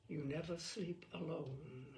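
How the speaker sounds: ordinary delivery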